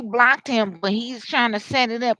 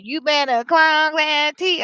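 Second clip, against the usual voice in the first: loud, higher, drawn-out sass; catchphrase imitation; 5 semitones higher, 6 dB louder than usual. Mimicking whoever talks like this, loud sass. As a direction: stretch it out like a sassy queen. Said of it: "whoever talk like this".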